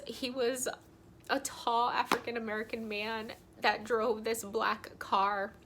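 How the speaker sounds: usual speaking voice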